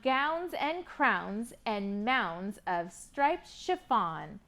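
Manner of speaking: ordinary delivery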